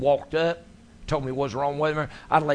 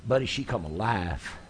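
ordinary talk

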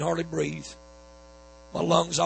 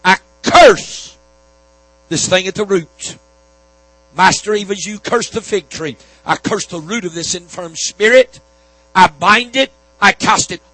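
shouted curse, much higher and louder; laying a curse; 3 semitones higher, 11 dB louder than usual. Acted. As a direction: roar the rebuke at the sickness. Preacher binding sickness in prayer.

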